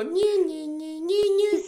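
high mock violin whine; tiny violin whine. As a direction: play a sarcastic tiny violin. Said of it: "así de violín chiquito".

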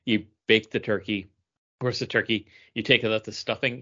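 ordinary delivery

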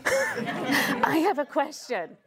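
slow, laughing mock plea; lightly voiced needy friend; mock texter. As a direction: drawl the text message teasingly. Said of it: "you love getting those messages".

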